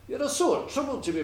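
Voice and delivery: high-pitched, mildly exasperated lassie. A narrator's reading. Lassie refuses the puddock's cruel order, exasperated.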